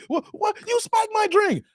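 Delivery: very high mock accusation; shocked woman act. Woman finding her drink spiked, mock high-pitched shock. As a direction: squeak it up as the startled victim.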